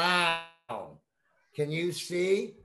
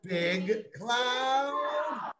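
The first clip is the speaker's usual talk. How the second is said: big cloud called high and bright; 8 semitones higher than usual. Shown as a demonstration. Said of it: "One more time".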